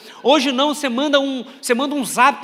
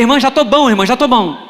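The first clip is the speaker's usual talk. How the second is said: loud, raised-pitch mock panic; urgent protest; 2 semitones higher, 10 dB louder than usual. Sick preacher stopping the sister's prayer, loudly insistent.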